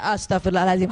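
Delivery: low, calmed husband saying the prayer; husband joining in. Acted. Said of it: "baru tuh yang kelima dia". (suami ikut beristighfar, suara rendah)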